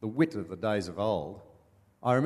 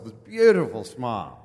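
normal talking voice

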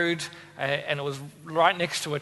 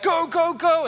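action hero urging; 9 semitones higher, slower than usual. Pretend. Imagined firefighter urging the crew on, high and hammed-up. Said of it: "they'd say cool things like".

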